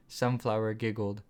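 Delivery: ordinary delivery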